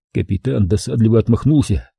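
ordinary delivery, nothing marked

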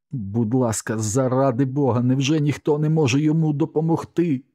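choked, crying plea. A narrator's reading. Woman begging anyone to help the dog, choked crying plea. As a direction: beg through tears, pitch rising steadily. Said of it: "голос був таким здавленим".

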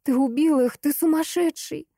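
high, hysterical yelling; hysterical accusation. Acted. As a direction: scream the accusation at him. Girl accuses killer, hysterical.

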